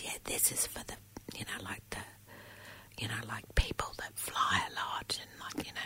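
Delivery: hushed, discreet retold whisper. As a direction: imitate someone explaining awkwardly in a whisper. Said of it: "she whispers in my ear".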